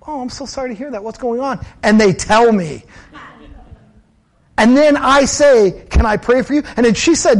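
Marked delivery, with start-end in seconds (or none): 0.1-1.6 softer, higher caring question; hushed, tender concern for a stranger; gentle sympathy. Retold or imitated. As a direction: say it quietly like you truly care. Voicing sympathy to cashier.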